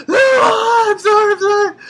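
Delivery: loud, high hammed-up wailing; crying. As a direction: wail the apology in mock tears. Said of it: "And she's crying".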